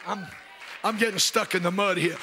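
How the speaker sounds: ordinary delivery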